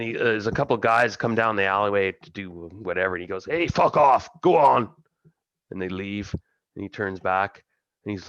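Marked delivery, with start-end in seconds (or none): 3.4-4.9 loud, high angry yell; full-voiced, raised-pitch dismissal; angry shout. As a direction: shout them off. Rotzak chases off passers-by.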